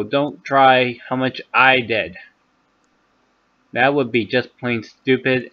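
usual speaking voice

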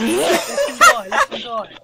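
high, slow laughing squeal. Real, not acted.

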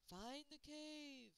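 slow, high, drawn-out; an eerie unseen voice giving advice; mysterious voice. A narrator's reading. The unseen voice tells the children to find the cave, slow and high. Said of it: "the voice said".

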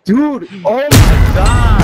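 hyped gamer bro. Real, not acted. Excited reaction to a game play.

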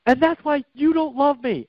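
high-pitched imitation of an upset daughter; mock tearful teenage accusation; worked-up teen. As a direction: wail it like a hurt teenager, half in jest. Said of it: "she's getting all worked up".

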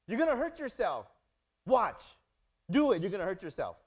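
exasperated parent warning; loud parental warning. Retold or imitated. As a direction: warn the reckless kid loudly.

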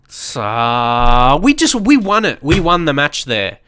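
normal talking voice

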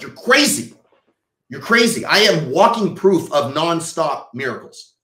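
loud, high-pitched conviction; fervent insistence. Real, not acted. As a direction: exclaim it like a believer testifying. Fervent rebuttal to miracle doubters.

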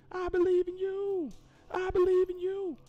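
hammed-up coach cheering the runner; mock encouragement. Mock pep talk to game character.

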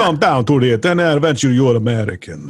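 put-on vampire KGB agent accent; Dracula. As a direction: do a hammy Slavic-accented vampire spy. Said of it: "he's a vampire K G B agent".

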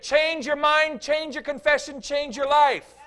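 very loud, slow, high commands; forceful command. Retold or imitated. Pastor ordering a whining counselee, loud and slow. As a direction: hammer each change home. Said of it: "I said".

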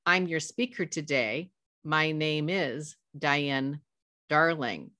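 slow, deliberate self-introduction, pausing inside the name. Shown as a demonstration. Speaker giving her name to an audience, slowly and deliberately. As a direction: space out first and last name, slowly. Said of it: "If I slow down and say".

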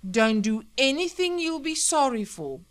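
loud, high-pitched warning; alarmed plea. Acted. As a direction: beg her urgently to stop. Husband faces a gun.

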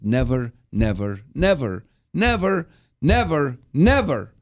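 climbing, hammered never never never; repeated emphatic never. Shown as a demonstration. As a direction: build the nevers up to a shout. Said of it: "that word never, it means it's a continuous basis".

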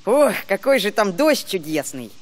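ordinary delivery

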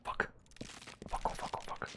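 trembling whisper. Real, not acted. Dying in the fight.

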